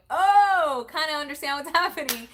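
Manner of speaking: high, loud gasp breaking into a laugh; stunned bride-to-be catching on; dawning surprise. Retold or imitated. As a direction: realise it mid-laugh. Realizing the proposal, laughs.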